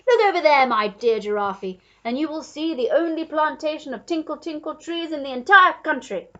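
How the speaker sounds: booming reassurance from a grand host; hearty duke. A narrator's reading.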